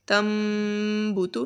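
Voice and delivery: تم with a nasal M hum into بوتو; nasal iqlab. Shown as a demonstration. Teacher models iqlab reading for the class. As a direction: hum the M of تم through the nose. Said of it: "ناک میں آواز لے کر جائیں گے".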